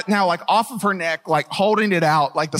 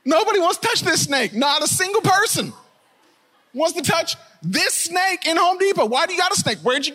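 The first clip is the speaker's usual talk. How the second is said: shout; 9 semitones higher than usual. Real, not acted. Preacher shouting to the church about the snake. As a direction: belt it out, half singing.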